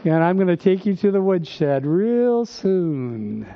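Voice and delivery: slow, measured, knowing warning; the Lord warns the young convert, slow and dry; the Lord answering. Retold or imitated. The Lord answering the young believer, slow knowing warning. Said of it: "And the Lord says".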